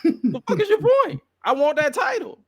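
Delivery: high-pitched mock defiance; playful, high-voiced comeback impression; imitated defiant Drew. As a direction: throw it back high, half laughing. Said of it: "He like".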